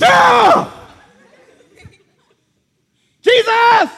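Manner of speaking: high, loud cry of amazement at the withered tree; astonished shout. Retold or imitated. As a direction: shout it out like you can't believe your eyes. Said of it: "Peter looks at the tree and goes".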